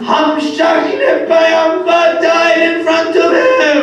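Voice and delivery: high, loud, slow shouted lament; wailing lament. Acted. Preacher crying out Hussein's and Zainab's words. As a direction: cry out to the mourners.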